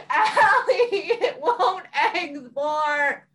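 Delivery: very slow, drawn-out whine; mock wail. Hanging off the bed complaining to Allie, mock wail. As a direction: moan it out, half laughing. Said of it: "just being like".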